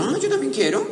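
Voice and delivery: put-on high, eager child's voice; a boy joining in the vote; eager kid. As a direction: pipe up like an excited boy. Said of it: "Y dijo otro".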